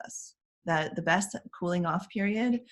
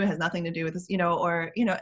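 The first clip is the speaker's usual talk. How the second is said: ordinary delivery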